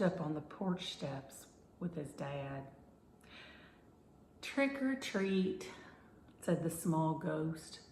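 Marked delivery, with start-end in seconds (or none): 4.4-5.7 put-on mildly sad child at the door; a touch of sadness. A narrator's reading. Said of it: "in a sad voice".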